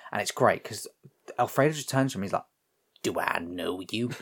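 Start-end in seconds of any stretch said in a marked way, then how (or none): 3.0-4.1 low, slow, softer question; cool challenge. Retold or imitated. Alfredo turning to the gunman, cool challenge. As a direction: size up a stranger slowly. Acted confrontation retold from film.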